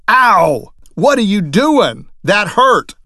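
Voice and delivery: sudden loud, high yelp of pain, then an indignant protest; hurt yelp. Acted. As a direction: yell as if someone just whacked your chair from behind. Acted juror hit from behind.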